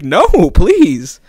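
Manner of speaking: high, loud retold plea; acted frightened begging; scared pleading. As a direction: beg him to back off, stretching the no. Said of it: "so submissive and scared".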